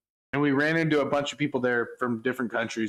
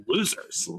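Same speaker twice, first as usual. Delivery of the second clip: higher-pitched mock jeer; retold dismissive jeer; 5 semitones higher than usual. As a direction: toss off an insult. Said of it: "I was like".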